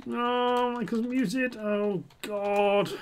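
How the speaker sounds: high, whiny self-pity, half-hearted; hammed-up whimper over the muted mic; mock wail. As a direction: wail it like a sulking kid.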